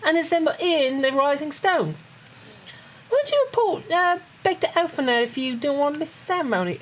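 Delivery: high-pitched Cockney character voice; Tataru. Acted. Player reads Tataru's ceremony orders to a friend, Cockney accent. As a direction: lift the voice high and keep it Cockney. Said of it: "It's hard to do a Cockney accent".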